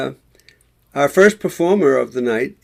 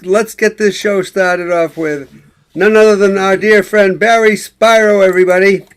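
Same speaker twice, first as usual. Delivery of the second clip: show host introduction; 2 semitones higher, 8 dB louder than usual. Real, not acted. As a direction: bring on the act with a big, loud build. Host introduces the first performer.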